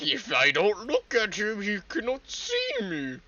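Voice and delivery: high, slow, loud mock thinking; exaggerated, drawn-out imitation; mock voice for creature. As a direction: drag it out in a high mocking voice. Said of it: "Was it sitting there like".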